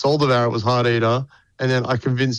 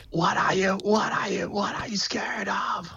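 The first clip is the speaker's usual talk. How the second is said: high, breathy whisper-scream; high whispered shriek; 8 semitones higher, 7 dB quieter than usual. Retold or imitated. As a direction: hiss it like a screaming ghoul. Imitating vocal on the record.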